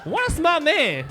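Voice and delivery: high, loud, amazed exclamation. Real, not acted. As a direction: marvel at it. Amazed comment.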